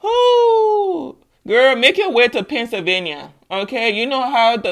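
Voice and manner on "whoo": high, loud "whoo"; piercing held whoop. Real, not acted. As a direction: let out a long high whoop. Excited outburst before call-out.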